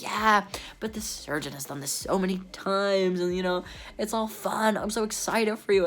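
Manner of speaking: high, sing-song cheer to calm a patient; chirpy assistant. Retold or imitated. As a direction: do the sweet, upbeat nurse on the phone. Assistant reassuring about the surgeon.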